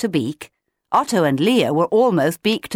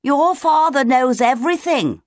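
high, raised chick's voice; shouting a bit; 8 semitones higher, 4 dB louder than usual. A narrator's reading. Leo answering Otto across the gap, shouting a bit. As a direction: call it over to a friend, pitched high. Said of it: "They had to shout a bit".

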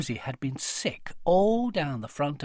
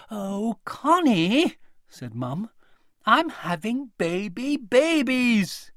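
gentle mum; 7 semitones higher, 4 dB louder, slower than usual. A narrator's reading. Mum gently breaking news.

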